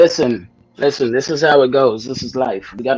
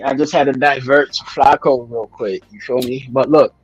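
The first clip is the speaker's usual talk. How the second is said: ordinary talk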